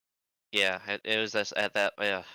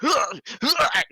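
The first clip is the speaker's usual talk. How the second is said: two loud, high effort noises; loud, comic grunts of exertion; imitated jumping grunts; 6 dB louder than usual. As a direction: mimic leaping down stairs with strained whoops. Imitating jumps down stairs.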